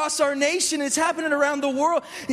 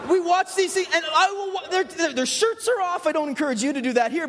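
ordinary talk, nothing marked

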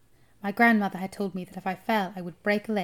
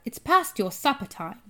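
raised, projected call; 4 semitones higher, 5 dB louder than usual. A narrator's reading. Grandmother summoning the boy to supper, calling out. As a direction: call up to someone overhead. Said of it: "she called out".

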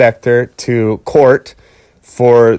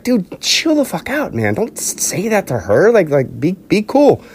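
higher, urgent pleading to calm down; calming a friend; 7 semitones higher than usual. Retold or imitated. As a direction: hold your buddy back, half-pleading. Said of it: "[name] is trying to stop him".

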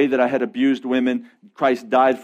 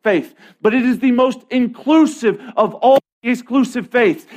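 high, driving sermon delivery; raised, emphatic pulpit voice; impassioned preaching; 9 semitones higher, 4 dB louder than usual. Real, not acted. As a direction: preach it with urgent force. Preaching at rising full force.

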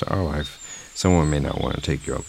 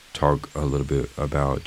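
usual speaking voice

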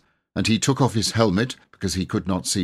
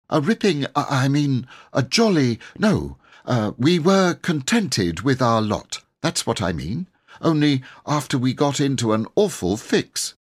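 lightly voiced boy, higher, stumbling over words; flustered boy correcting himself; 8 semitones higher, 3 dB louder than usual. A narrator's reading. Robert fixing his slang before the knight, flustered boyish voice. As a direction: catch yourself mid-word like a nervous lad.

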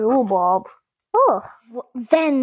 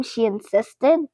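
usual speaking voice

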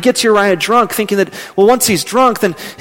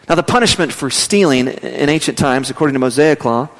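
usual speaking voice

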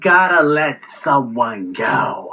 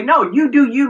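higher, quicker, easygoing version of the phrase; well-meant version; 8 semitones higher than usual. Retold or imitated. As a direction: offer it as harmless permission. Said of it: "meaning, okay, do what you need to do".

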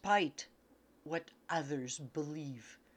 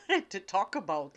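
ordinary delivery

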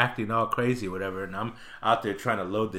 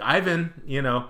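put-on higher, louder call to a climbing boy; calling the child; 6 semitones higher, 5 dB louder than usual. Mother calling her misbehaving son across the store. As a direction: call him back with rising volume.

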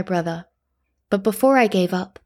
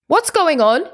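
high, loud alarmed shout; 9 semitones higher, 5 dB louder than usual. A narrator's reading. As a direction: shout it out of the dark. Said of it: "another villager shouted".

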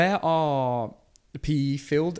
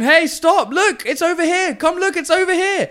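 put-on high, loud, urgent child impersonation; shouting kid; 6 dB louder than usual. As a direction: call a stranger over like an insistent kid. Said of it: "Why are you shouting at the man?".

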